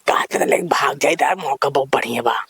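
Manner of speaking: hushed scheming whisper. Acted. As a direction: keep it low, plotting in secret. Secret plan to elope, whispered. (धीमी फुसफुसाती आवाज़)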